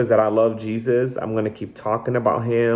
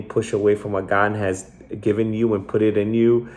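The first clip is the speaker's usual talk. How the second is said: normal talking voice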